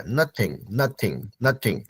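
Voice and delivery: nothing repeated softly as nath plus ing; pronunciation demo. Shown as a demonstration. Teacher repeats nothing to students, soft and clear. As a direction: sound out nothing as nath then ing, fading. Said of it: "तो nothing, नथ में आईएनजी लग गया".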